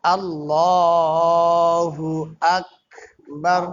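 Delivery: আল্লাহু আকবার drawn out very slowly, ক bounced, rising end; slow recited takbir. Shown as a demonstration. Teacher recites the takbir for students, slow and chanted.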